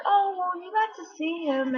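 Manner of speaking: high, upbeat and unhurried; upbeat imitation. Marianne cheerfully consoling Helen, imitated high and upbeat.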